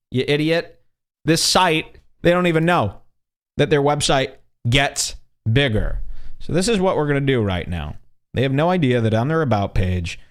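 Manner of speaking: ordinary talk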